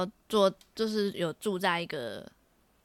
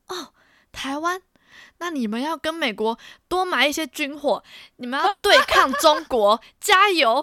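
louder, higher, hammed-up cheer from an English stranger; quoting enthusiastic stranger; 8 semitones higher, 10 dB louder than usual. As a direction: play the over-eager stranger urging her on, stressing 多. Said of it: "他是这样跟我讲". (激动地模仿陌生人打气)

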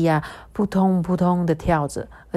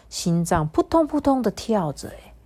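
usual speaking voice

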